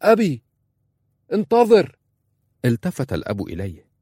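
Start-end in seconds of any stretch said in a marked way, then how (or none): 0.0-2.0 higher, louder desperate shout. Acted. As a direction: yell after him across the water. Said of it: "صاح أنس".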